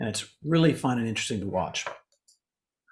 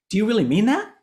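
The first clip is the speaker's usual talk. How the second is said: "that?" lifted high and a bit louder; rising question; 7 semitones higher, 3 dB louder, faster than usual. Shown as a demonstration. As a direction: ask it with a clear upward tune. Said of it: "We go up".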